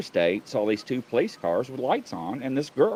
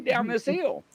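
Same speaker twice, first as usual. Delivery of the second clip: usual speaking voice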